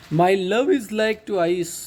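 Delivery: slow, drawn-out reading of a poem line; measured, drawn-out poem reading; slow poem recitation. Shown as a demonstration. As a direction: recite each word slowly to the class.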